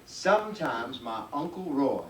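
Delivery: ordinary delivery, nothing marked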